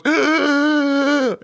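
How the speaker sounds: a short, high imitated groan of pain. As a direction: grunt like you just got hit in the groin. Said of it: "His reaction was".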